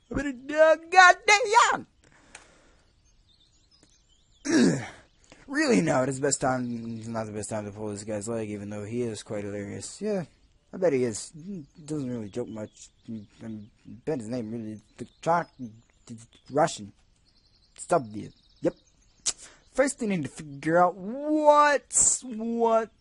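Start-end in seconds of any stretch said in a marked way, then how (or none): 0.1-4.8 exaggerated yawning exclamation. Exaggerated loud yawn. 19.7-22.9 slow character voice. Acted. Reads line slow high.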